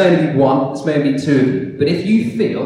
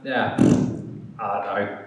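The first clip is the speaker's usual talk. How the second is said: slow, low, trailing-off dodge; hesitant, embarrassed shrug of a reply; sheepish mumble; 4 semitones lower, 3 dB quieter, much slower than usual. Retold or imitated. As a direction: mumble an awkward non-answer. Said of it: "I kinda just go".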